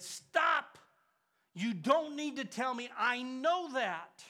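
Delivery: loud, high and urgent, the last line drawn out slow; urgent cry. Retold or imitated. Dying mentor stopping his student, high loud urgent cry. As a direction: cut him off with a sharp high cry.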